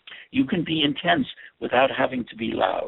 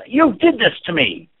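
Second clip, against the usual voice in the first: loud, slow, angry accusation; loud furious blame; 5 semitones higher, 8 dB louder than usual. Shown as a demonstration. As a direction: throw it at them hard. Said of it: "Or if it's".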